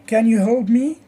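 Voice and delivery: rising teacher's tune on help me; model question intonation. Shown as a demonstration. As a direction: ask it for students to copy, voice climbing on me. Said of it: "copying my stress and intonation".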